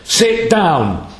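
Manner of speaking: sharp, high barked order; emphatic command. Shown as a demonstration. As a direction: snap Sit down! like an order to obey now. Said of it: "except by the tone of your voice".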